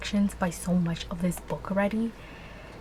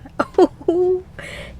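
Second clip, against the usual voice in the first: squealing laugh; 10 semitones higher, 8 dB louder than usual. Real, not acted. Overwhelmed by romantic scene.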